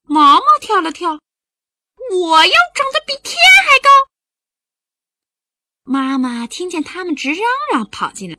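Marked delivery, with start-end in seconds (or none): 2.1-3.9 much higher, louder and fast; squealing child boast. Acted. Maomao jumping to outdo Songsong, high fast squeal. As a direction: shriek it while jumping up and down.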